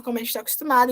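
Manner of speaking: ordinary talk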